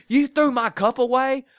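hammed-up angry mother; acted outrage. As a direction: play the furious mom for the setup. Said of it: "awesome acting".

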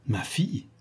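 understated agitated question, rising at the end; startled query with a sharp final rise; agité. A narrator's reading. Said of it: "s'écria-t-il avec la plus vive agitation". (agitation contenue, question montante)

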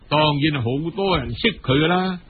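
usual speaking voice

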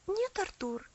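ordinary delivery

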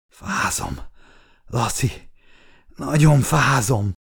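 halting, strained words with long pauses; dying and freezing. A narrator's reading. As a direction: struggle to speak as life slips away. Dying friend, freezing, fading.

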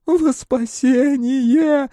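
very high, slow fanatic cry; long, high ecstatic call; alien crowd voice. A narrator's reading. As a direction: wail it out as a zealous chant. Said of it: "чужих и настоящих".

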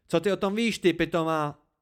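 high, loud angry retort; furious outburst. A narrator's reading. Narrator lashes out at Dena, furious. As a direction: let the anger burst out. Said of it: "jako když se rozletí dvířka pece".

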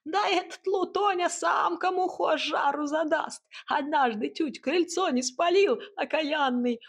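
high-pitched, emphatic cackling voice; clucking hut. A narrator's reading. Hut tells of the boy's mischief, high clucking voice. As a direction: complain high and animated like a clucking hen. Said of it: "кудахтала изба".

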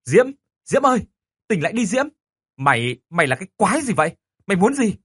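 frantic high shouting; furious shout. Acted. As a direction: yell in panic and rage. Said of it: "Tôi điên tiết lên quát". (hét lên giận dữ)

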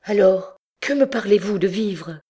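high-pitched cold challenge, loud at first; icy retort. A narrator's reading. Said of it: "dit-elle toujours glaciale et immobile".

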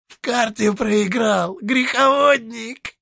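high, creaky devil voice; a creaky, mocking old devil.

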